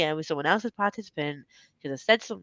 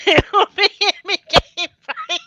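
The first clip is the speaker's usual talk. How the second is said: high, loud, squeezed through laughter; real laughter, louder and higher; laughing; 12 semitones higher, 9 dB louder than usual. Real, not acted. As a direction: talk through helpless giggles. Laughing hard at clip.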